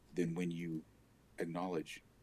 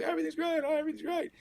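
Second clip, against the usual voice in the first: high, bright-sounding put-on cheer, mocking; overly chipper mock greeting; mock fake cheer; 4 dB louder, faster than usual. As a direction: play the fake everything's fine act. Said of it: "every time I see you".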